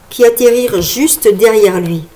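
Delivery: plain reading voice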